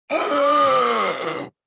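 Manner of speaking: loud high shrieking gasp; drowning gasp. Retold or imitated. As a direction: gasp awake like a drowning victim. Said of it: "She'd be like".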